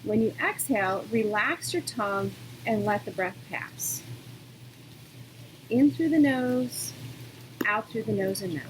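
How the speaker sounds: calm, unhurried yoga-teacher voice with long pauses; guided meditation. Real, not acted. Yoga teacher settling students into breathing, calm guidance.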